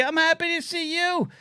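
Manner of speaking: cutesy high reply. Pretend. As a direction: coo it back like to a child. Answers chat in cutesy voice.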